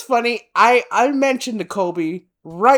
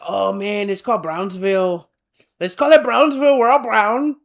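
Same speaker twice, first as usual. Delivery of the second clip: put-on excited Mexican accent, building louder; 3 semitones higher, 4 dB louder than usual. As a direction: put on a Mexican accent and get carried away. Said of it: "I could do that accent".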